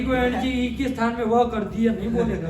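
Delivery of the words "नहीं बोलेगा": ordinary delivery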